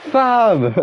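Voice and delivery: high, punchy shout of Fam!; sudden excited yell. Real, not acted. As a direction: call it out big and high. Calling out to friend excitedly.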